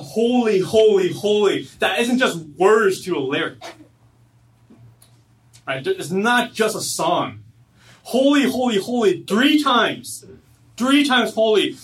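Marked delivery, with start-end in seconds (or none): 0.0-1.7 loud, high holy cry; calling out holy. Retold or imitated. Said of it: "when they call out". 8.0-11.8 each holy hit loud and high; emphatic repetition. Shown as a demonstration. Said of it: "is an emphasis".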